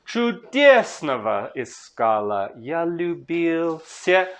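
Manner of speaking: slow, measured reading that leans on each stressed beat; slow Russian verse recital; slow poetry recitation. Shown as a demonstration. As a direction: read the verse slowly, marking the rhythm. Reciting the next verse line.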